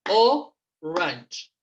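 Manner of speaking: "Orange" broken in two, O, long pause, range; syllable by syllable. Shown as a demonstration. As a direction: split "Orange" after a strong first O, then range. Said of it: "¿Cuántas tiene? Dos". ("Orange" separado en dos sílabas: o, range)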